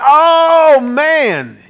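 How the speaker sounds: a sudden loud, high Oh from the quoted wife; exasperated shout. Retold or imitated. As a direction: blurt it out like a fed-up wife. Wife scolding him, quoted.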